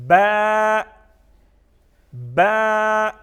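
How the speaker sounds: با loud with a long, level held vowel; stretched letter. Shown as a demonstration. Teacher models next letter for pupils, با drawn out loud. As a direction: stretch با evenly, like a recited note. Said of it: "اس کو تھوڑا سا کھینچ کے پڑھنا ہے".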